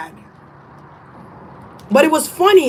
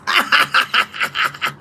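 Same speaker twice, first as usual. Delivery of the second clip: airy laugh; 4 semitones higher than usual. Real, not acted. Laughs at own advice.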